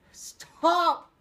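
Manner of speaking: loud high desperate cry; gasping for him to stop; gasped cry. A narrator's reading. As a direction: cry out in pain. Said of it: "Cole gasped".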